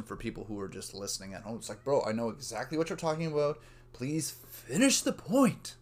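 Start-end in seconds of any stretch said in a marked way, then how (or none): 1.8-5.8 raised-pitch pleading, last words stretched; mock exasperated listener. Acts out exasperated listener at home.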